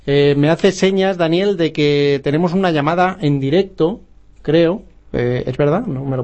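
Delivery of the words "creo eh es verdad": normal talking voice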